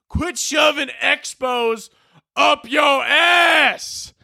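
high, drawn-out Southern yell; attempted Southern accent. Dad scolding Connor about markers, mock Southern shout. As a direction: stretch each word into a hammed-up Southern holler. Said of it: "I'm trying to do a southern accent".

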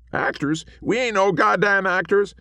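high, loud indignant cowboy; bristle, high and loud; offended little ghost. A narrator's reading. Small man offended.

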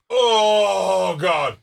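imitated relief. Imitating man relieving himself outdoors.

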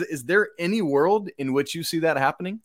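ordinary delivery

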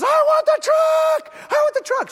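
very high, loud, drawn-out mock tantrum; screechy high child impersonation; imitated child yelling. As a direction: yell it slowly like a toddler at full volume. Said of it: "he's, like, yelling".

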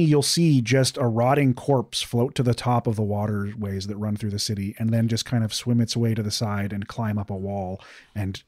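usual speaking voice

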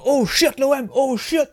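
high, loud excited mock shout; hammed-up cry from Le dîner de cons; movie character impression. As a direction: yell it like a fan cheering his team.

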